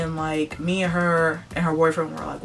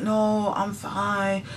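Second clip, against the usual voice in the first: hushed, higher voice of a coworker; imitated coworker; 3 semitones higher, slower than usual. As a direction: play the unwell girl answering meekly. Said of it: "And the girl's like".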